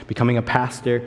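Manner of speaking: ordinary talk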